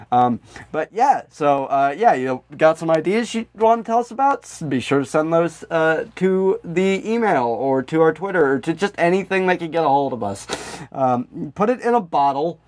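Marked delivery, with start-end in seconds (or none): none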